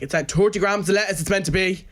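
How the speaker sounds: mock stickler manager scolding the staff; strict boss. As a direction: nag about the lettuce like a fussy boss. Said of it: "and she'd be like".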